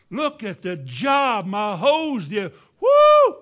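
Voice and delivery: laughing, slow boastful yell building to a loud whoop; mock bragging shout. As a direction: crow over the hose like a show-off. Bragging about his hose, mocked.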